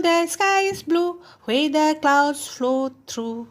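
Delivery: slow, sing-song classroom poem reading; measured question-and-answer verse with drawn-out answers; poem recitation. Shown as a demonstration. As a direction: recite it slowly for young pupils, stretching the rhyme words.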